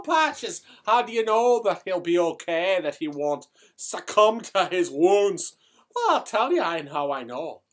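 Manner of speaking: thick stage Irish brogue throughout. Acted. Comic Irish character telling a tale to the audience in a brogue. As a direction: keep the comic Irish lilt going.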